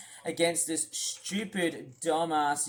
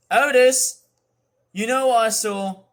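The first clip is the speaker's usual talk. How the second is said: loud, pitched-up confrontation; raised-voice accusation; angry calling out; 5 semitones higher, 9 dB louder than usual. Real, not acted. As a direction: call him out sharply and loudly. Calling out the streamer angrily.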